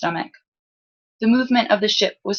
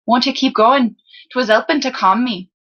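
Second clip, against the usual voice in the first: higher-pitched, friendly lower-class Salzen lad; lower-class Salzen accent; 5 semitones higher, 4 dB louder than usual. A narrator's reading. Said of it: "belonged to the lower class of Salzen".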